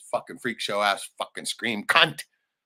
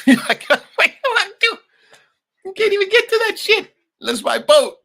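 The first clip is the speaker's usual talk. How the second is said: much higher, louder, laughing outrage; laughing disbelief; 12 dB louder than usual. Real, not acted. Laughing at the brother's lie about the land. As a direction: cackle at the absurd claim.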